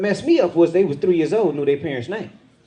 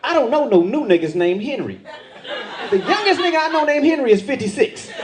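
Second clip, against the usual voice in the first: protective father rant; 8 semitones higher than usual. Retold or imitated. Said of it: "the father in me jumped out".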